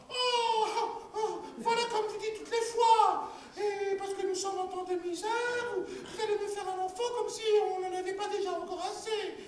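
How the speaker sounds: high, plaintive woman's voice, half crying; wife's high whining lament. Acted. Wife protesting to her husband, high and tearful. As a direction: whine and protest like a fretful peasant wife.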